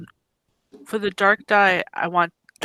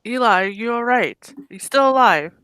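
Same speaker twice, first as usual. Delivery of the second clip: loud, raised call to someone below; higher, projected in-character shout; 6 semitones higher, 6 dB louder than usual. Acted. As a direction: call down to check on a companion. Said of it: "I shout down".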